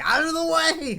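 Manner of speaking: high, loud mimicked shout; mock scooter lady yell. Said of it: "she was like".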